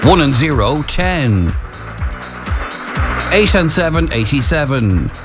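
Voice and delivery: measured number calling with pauses; bingo caller. Acted. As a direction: call each number, then repeat it combined.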